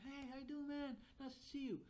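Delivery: high, peppy hello; hammed-up cheery welcome; upbeat greeting. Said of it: "it can be a good start of a conversation".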